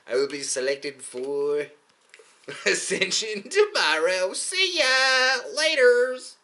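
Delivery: drawn-out, high singsong send-off; gleeful mock farewell. Chosen one leaving for ascension, hammed-up gleeful farewell.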